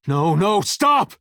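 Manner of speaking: high-pitched shout to stop; alarmed cry. Acted. Pleading with someone to stop, alarmed shout. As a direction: cry out, rising sharply to the last word.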